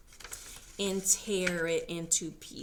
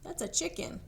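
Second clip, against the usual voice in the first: ordinary delivery, nothing marked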